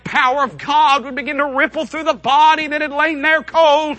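climbing, high-pitched proclamation; rising preaching fervor. Real, not acted. Preacher announcing the third day, rising fervor. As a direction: build toward the resurrection with ringing force.

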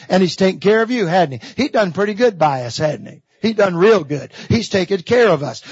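usual speaking voice

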